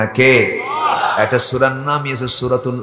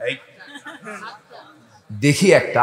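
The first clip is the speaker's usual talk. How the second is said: normal talking voice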